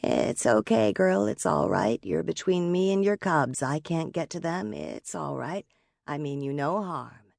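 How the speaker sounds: very soft, hushed reassurance; soothing monotone. A narrator's reading. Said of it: "what she hoped was a soothing monotone".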